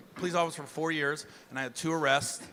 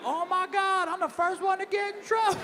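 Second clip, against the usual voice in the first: high, thin panicked voice; panicked recruit; 12 semitones higher than usual. Retold or imitated. Recruit panicking after getting caught, high squeal. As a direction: squeal it like a scared kid. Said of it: "And I was like".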